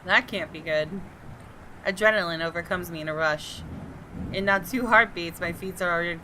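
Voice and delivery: steady, measured first-person narration; story narration. Acted. Reading the detective's inner monologue to viewers, measured narration. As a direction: read it like a tense mystery novel. Reads game narration aloud.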